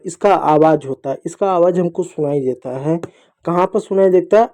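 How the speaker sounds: ordinary talk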